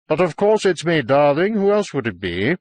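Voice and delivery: mildly exasperated shout upstairs. A narrator's reading. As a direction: call up to her, pitch lifted then settling. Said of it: "I shouted up to her".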